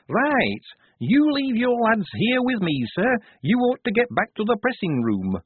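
high, firm laundry woman ordering an officer; brisk laundry mistress. A narrator's reading. Missus Enid sends Blouse back to work, firmly in charge. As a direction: take charge, pitched well up.

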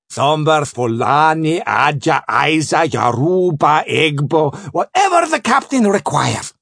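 put-on foreign-accented trader rattling off a list, soaring at the end; eager Sanchez. Sanchez offering Spring his choice, eager trader. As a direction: offer everything eagerly, building to a high finish.

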